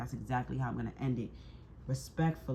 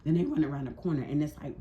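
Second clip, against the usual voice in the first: ordinary talk, nothing marked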